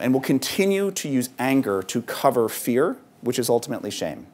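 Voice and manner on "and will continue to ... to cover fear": ordinary talk, nothing marked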